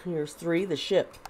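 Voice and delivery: ordinary talk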